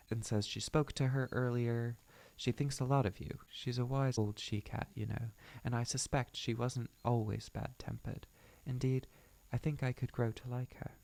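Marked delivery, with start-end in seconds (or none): 2.4-10.9 put-on British accent for a leader. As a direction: reflect aloud in a British accent.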